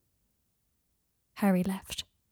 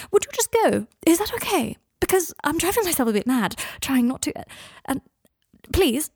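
desperate pleading; 8 semitones higher, 4 dB louder than usual. Acted. Draco begs Harry to leave.

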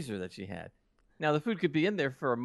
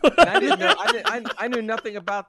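much higher and louder, laughing through the words; 10 semitones higher, 12 dB louder than usual. Real, not acted. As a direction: squeal it out mid-laugh. Laughing hard while telling.

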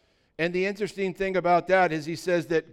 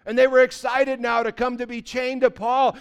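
fiery preaching; 6 semitones higher, 7 dB louder than usual. Acted. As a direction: build the crowd up with fire. Preaching at full force.

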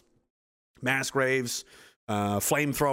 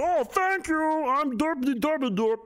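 high-pitched goofy fool voice; mock yourself as a grateful dope; derpy dimwit; 13 semitones higher, much slower than usual. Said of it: "and I'd be like".

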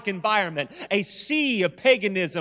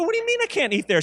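high, fast disbelieving squawk; incredulous protest; 11 semitones higher, much faster than usual. Retold or imitated. Husband objecting to his wife's restaurant ban, high incredulous squawk. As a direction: play the outraged husband, pitch jumping up.

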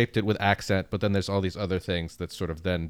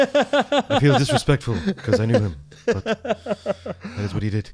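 Robin Williams impression; 6 semitones higher, 6 dB louder than usual. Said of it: "Robin Williams".

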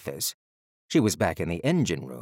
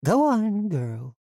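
loud, high-pitched start dropping on the last word; urging; 12 semitones higher, 4 dB louder, slower than usual. A narrator's reading. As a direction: coax her on in a high voice. Supervisor urging her to door.

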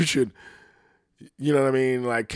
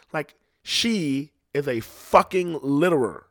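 ordinary delivery

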